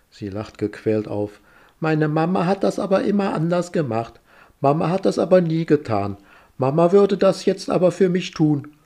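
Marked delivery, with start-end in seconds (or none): 1.8-8.7 high whiny mocking child voice; whiny tattling little sibling. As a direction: whine it like a spoiled kid. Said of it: "mit quengeliger Stimme".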